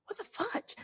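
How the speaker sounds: very soft, high mock disbelief; tiny high whisper of confusion; hushed bewilderment. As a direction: say it under your breath, baffled. Retells muttered reaction to tapping.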